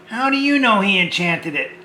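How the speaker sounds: usual speaking voice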